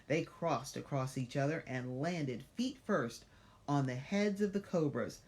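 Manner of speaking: ordinary delivery, nothing marked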